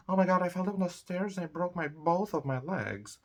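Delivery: high, fast hammed-up whine; mock the injured person; whining over broken legs, mock; whiny complainer. Imitating someone complaining.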